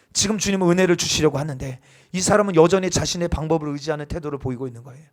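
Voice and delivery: ordinary talk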